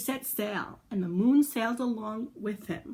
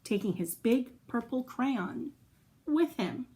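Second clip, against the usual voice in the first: usual speaking voice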